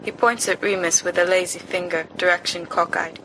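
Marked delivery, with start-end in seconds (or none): none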